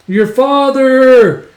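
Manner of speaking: high, loud, long-drawn shout; calling out. Retold or imitated. Child bringing urgent message to father, calling out. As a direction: holler it with the last word stretched.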